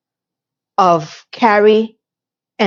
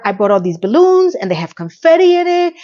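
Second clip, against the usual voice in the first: pitched up, gushing about balloons; excited friend; 8 semitones higher than usual. Retold or imitated. Imitating Miranda bragging about balloons, bubbly.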